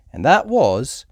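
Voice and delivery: ordinary delivery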